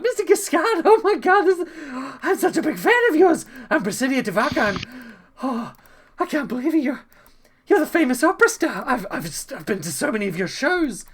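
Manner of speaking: high, loud, breathless gushing; gushing fangirl. Acted. Dragonborn bard gushing over the opera star, high and loud. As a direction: gush over your idol at high pitch.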